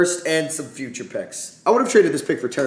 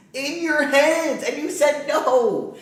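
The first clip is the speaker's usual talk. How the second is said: high, very slow and drawn out; incredulous frustration; 10 semitones higher, much slower than usual. Real, not acted. Fan scolding his team for passing on players. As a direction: stretch each word in disbelief. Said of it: "I'm pissed".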